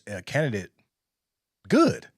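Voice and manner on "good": emphatic good. Real, not acted. Approving the Post's choice.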